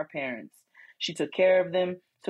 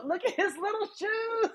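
cooing. Real, not acted. Cooing over child's photo.

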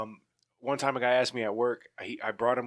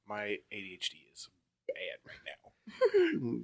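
hushed breathy confession, voice dropped to a whisper; 3 semitones lower, 9 dB quieter, slower than usual. Real, not acted. Co-host whispering an aside to the podcast listeners. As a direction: whisper it like a guilty secret.

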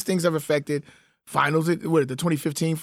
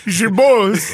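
put-on louder, higher joke Yoruba accent; mock Yoruba accent; 4 semitones higher, 7 dB louder than usual. Repeating the joke over the co-host's laughter, mock Yoruba accent. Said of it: "that's my Yoruba accent".

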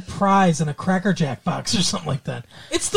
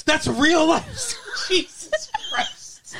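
laughing disbelief; 8 semitones higher, much slower than usual. Real, not acted.

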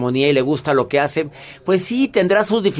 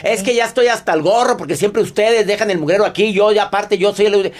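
higher-pitched, fed-up rant; mock angry yelling; 6 semitones higher than usual. Doctor imitating a quarrelsome person complaining about the mess, mock ranting. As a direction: play someone ranting at full tilt, without real shouting. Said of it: "estás gritando".

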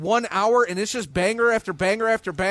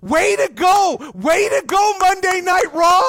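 loud cheer; 8 semitones higher, 5 dB louder, slower than usual. Real, not acted. Loud mock cheer for Raw.

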